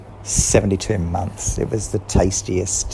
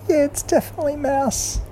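much higher Kat voice; Kat admitting. A narrator's reading. Kat to Girl, much higher, admitting. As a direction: Kat owns it outright. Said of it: "And Kat said".

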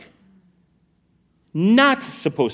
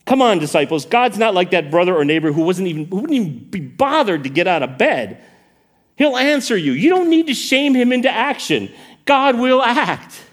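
incredulous appeal that rises in pitch; incredulous Jesus; 3 semitones higher than usual. Acted. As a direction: urge them as if it should be obvious. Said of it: "You can hear that incredulous tone".

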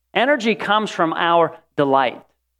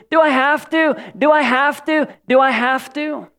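mock whine, pitched way up; whining complaint; 10 semitones higher than usual. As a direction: nag it like a sulky child.

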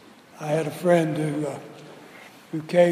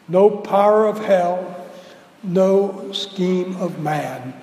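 recited hymn; slower than usual. Acted.

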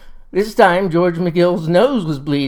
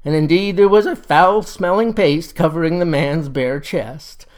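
ordinary delivery, nothing marked

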